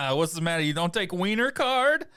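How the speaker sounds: high taunting biker voice; mocking biker. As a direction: sneer it up high and loud.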